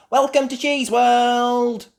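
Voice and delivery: very high, loud, slow greeting; announcing Miss Rabbit. Acted. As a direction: welcome the guests like a showy host. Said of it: "announced Miss Rabbit".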